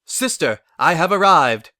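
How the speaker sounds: loud, proud royal arrival; grand announcement. Acted. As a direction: announce it to the room with full chest. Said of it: "Luna announced".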